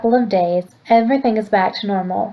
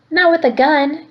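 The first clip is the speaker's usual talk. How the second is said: higher, tearful outburst; yelling; 7 semitones higher than usual. Acted. Grandmother arguing with her husband, tearful yell. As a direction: cry out against the gun. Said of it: "Graham yells".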